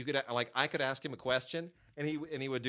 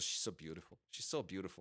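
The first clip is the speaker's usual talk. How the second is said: softer, lower murmured Van Damme imitation; mock Van Damme; 5 semitones lower, 8 dB quieter than usual. Critic mimicking Van Damme mooning over a reporter, softer and lower. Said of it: "He goes".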